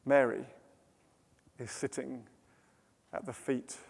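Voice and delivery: very soft, low, with long pauses; serene hush. A narrator's reading. Serene refrain against Martha's frantic rush.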